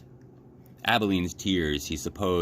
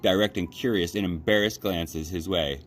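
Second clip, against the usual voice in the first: usual speaking voice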